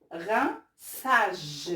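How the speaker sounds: "rinçage" in two parts, with an S sound; model pronunciation. Shown as a demonstration. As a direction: sound out "rinçage" for beginners, S in the middle. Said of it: "comme dans le mot".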